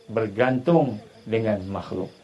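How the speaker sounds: normal talking voice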